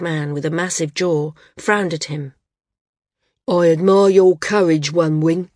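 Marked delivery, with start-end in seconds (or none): 3.5-5.5 a hint of a big man rumbling, louder; lightly voiced big man rumbling, a bit louder. A narrator's reading. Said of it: "he rumbled".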